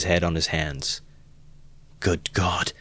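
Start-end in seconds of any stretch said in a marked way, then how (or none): 2.0-2.7 stricken cry. A narrator's reading. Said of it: "he cried".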